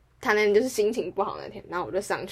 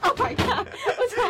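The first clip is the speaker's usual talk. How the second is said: high startled cry of surprise; shocked exclamation. Real, not acted. Tutor hearing her mom drop the rice cooker, startled cry. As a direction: jump up in alarm at the noise. Reacting to crash at home.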